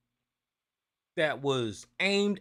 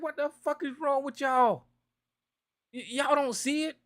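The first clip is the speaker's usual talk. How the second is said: pitched way up in disbelief; high incredulous outburst; 9 semitones higher than usual. Retold or imitated. A talk show host baffled at Trump-supporting neighbors, high incredulous outburst. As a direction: voice jumping high, baffled and exasperated. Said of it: "And I'm like".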